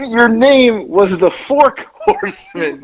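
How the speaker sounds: high and slow, laughing through it; barely holding it together while quoting him; laughing quote. Retold or imitated. Retelling a friend's dream name, laughing.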